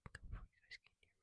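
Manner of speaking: quick soft whisper; hurried ASMR whisper; fast whisper. Real, not acted. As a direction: whisper it fast so nobody hears.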